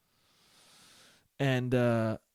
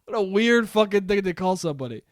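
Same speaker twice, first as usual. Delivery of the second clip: ordinary talk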